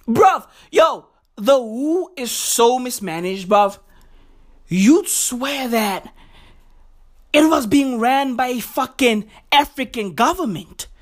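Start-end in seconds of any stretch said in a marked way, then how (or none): none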